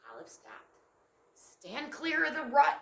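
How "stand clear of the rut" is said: a hint of a bossy squirrel, louder; instructing squirrel. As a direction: give the order like a busy squirrel. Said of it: "instructed the squirrel".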